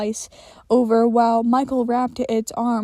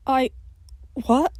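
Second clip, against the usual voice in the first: halting, stammered words; stammering; 3 semitones higher than usual. A narrator's reading. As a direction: trip over the words. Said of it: "he stammered".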